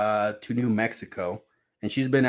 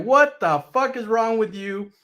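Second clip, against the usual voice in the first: angry yell; 9 semitones higher, 4 dB louder than usual. Retold or imitated. TV thrown at him.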